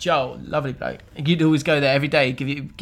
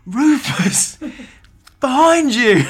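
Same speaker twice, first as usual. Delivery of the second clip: loud, higher warning call; retold shout; 9 semitones higher, 8 dB louder, much slower than usual. Fan re-enacting his warning to Rufus at a corner, shouting. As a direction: call it out long and loud across a pitch. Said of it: "I remember shouting".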